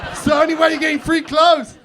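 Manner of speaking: high, loud comic protest; emphatic outburst. Acted. South African explaining free clothes to crowd, emphatic shout. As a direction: shout the punchline insistently.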